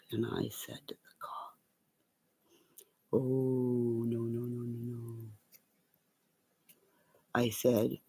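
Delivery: low, hushed confiding murmur, partly whispered. Real, not acted. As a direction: lean in and tell the secret. Hushed threat and story.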